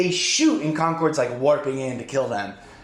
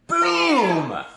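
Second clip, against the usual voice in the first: high, drawn-out falling "boom"; triumphant vocal sound effect; exclaimed "boom"; 9 semitones higher than usual. Retold or imitated. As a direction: cap the story with a big explosive boom. Triumphant punctuation of the story.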